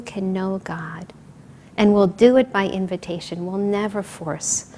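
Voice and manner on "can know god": slow whispered close; hushed whisper. Real, not acted. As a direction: drop to a whisper on the promise. Promise trails into hush.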